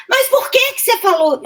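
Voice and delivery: high, sharp mimic of the old lady's question; imitating her outburst. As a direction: pitch it way up like a blurting woman. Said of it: "ela virou e falou assim". (imitando a senhora, bem agudo)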